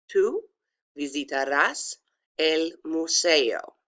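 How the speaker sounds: careful Spanish with emphasis on the "ás" in "visitarás"; pronunciation demo. Shown as a demonstration. Teacher repeating for students, careful pronunciation demo. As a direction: say "tú visitarás el museo" piece by piece, stretching "el museo". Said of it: "Again, it's pronounced".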